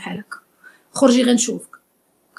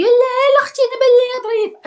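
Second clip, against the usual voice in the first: much higher, louder mock girly voice; mocking naive girl; 13 semitones higher, 3 dB louder than usual. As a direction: parody a girl defending her boyfriend, pitched way up. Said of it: "تقولي".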